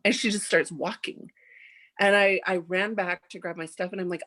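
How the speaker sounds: ordinary delivery, nothing marked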